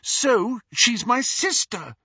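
high, loud, drawn-out indignation; high-pitched wounded protest; upset retort. A narrator's reading. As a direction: snap back, hurt and cross. Upset Lily snaps at Snape.

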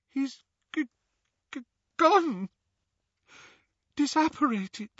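high, broken, stammering grief; choked up. Acted. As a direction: choke out the words through tears. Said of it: "started to cry".

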